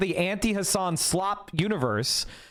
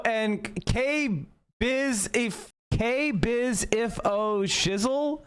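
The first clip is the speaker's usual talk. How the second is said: slowly sounding out the name; careful slow read; slow drawn-out name reading; 4 semitones higher, much slower than usual. Real, not acted.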